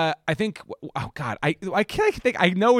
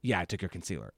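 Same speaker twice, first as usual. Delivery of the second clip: much softer and lower, caught out; sheepish confession; 10 semitones lower, 7 dB quieter, faster than usual. Retold or imitated. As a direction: mumble it like a caught child. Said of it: "And then Stella goes".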